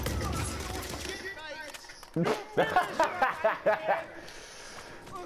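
long high-pitched fit of laughter; helpless laughter. Real, not acted. Gamer cracking up at a rival's accent, helpless laughter.

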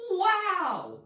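high, loud, drawn-out exclamation; exclaimed wow. Shown as a demonstration. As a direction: let it burst out big. Acts out exclamation in example.

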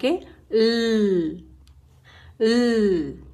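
ल as a held tongue-behind-teeth l, no vowel; l without vowel. Shown as a demonstration. Teacher showing kids the l phonics sound, ल held with no vowel. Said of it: "सिर्फ टंग को दांतों के पीछे लगा".

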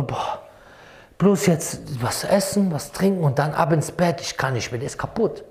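exhausted groan. Retold or imitated. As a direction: drag the words out like you can barely stand. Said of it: "der ist so kaputt".

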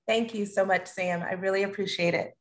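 ordinary talk, nothing marked

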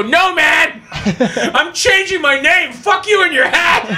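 high, drawn-out angry shout; mock furious refusal. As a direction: storm off yelling in a staged tantrum. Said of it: "gets mad at me".

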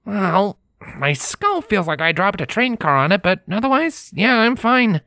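lightly voiced stallion, brushing it off; a hint of wincing bravado; shrugging stallion. Lenz waving off Scarlet's worry, mildly wry.

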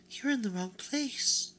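a vain china doll sizing up a stranger; high-pitched doll voice. Acted. China doll asks the new rabbit what he is, high-pitched.

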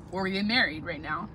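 talking between bites; chewing while speaking; mouth partly full. Real, not acted. Seen 3: eats from bowl between bites while speaking throughout.

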